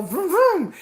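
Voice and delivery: high buzzing vroom vroom like a power drill; playful drill sound effect; drill noise. Retold or imitated. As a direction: mimic a drill whirring.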